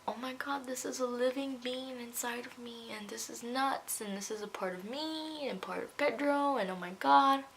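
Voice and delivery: high-pitched retold wonder; awed amazement. Mother-to-be hearing the heartbeat, amazed. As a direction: marvel at it, voice lifted.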